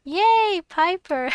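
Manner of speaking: high loud happy cheer; excited. Real, not acted. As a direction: squeal with delight. Delighted cheer at character.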